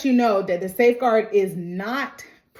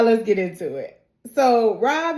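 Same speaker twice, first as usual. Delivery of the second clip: normal talking voice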